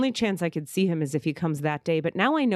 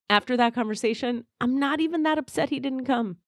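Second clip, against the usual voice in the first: ordinary talk, nothing marked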